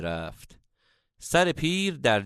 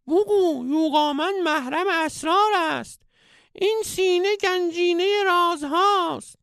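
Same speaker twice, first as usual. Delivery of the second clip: very high, piping wise-man voice; comic falsetto old master; squeaky old sage; 3 dB louder than usual. A narrator's reading. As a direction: play the sage as a squeaky cartoon guru.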